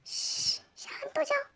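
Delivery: soft unvoiced whisper, soothing. Chef quietly calms the panicking girl, soft whisper. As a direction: whisper it so nobody outside hears. Calming her secretly.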